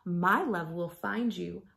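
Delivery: usual speaking voice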